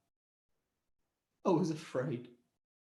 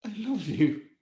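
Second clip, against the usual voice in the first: tender whisper; 2 semitones lower than usual. Retold or imitated. Whisper to baby.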